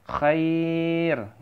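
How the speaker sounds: long drawn "Khair" on a steady note; lengthened ending. Shown as a demonstration. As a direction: stretch the middle of "Khair" before the stop. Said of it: "jadinya panjang ya".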